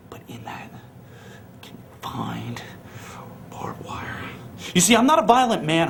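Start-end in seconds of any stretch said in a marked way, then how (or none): 0.1-4.2 much softer and lower, hissed threat; menacing whisper. Acted. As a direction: whisper it like a promise of pain. Threat about barbed wire match.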